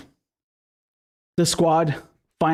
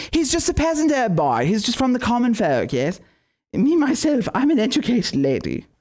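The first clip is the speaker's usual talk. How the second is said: much higher, snooty mocking voice; mock snooty Nomiki; 6 semitones higher than usual. Mocking a rival who talks down to common folk, in a high snooty voice. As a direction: talk down like a snob who thinks she's better. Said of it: "she's like".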